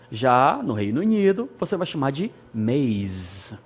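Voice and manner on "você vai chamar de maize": normal talking voice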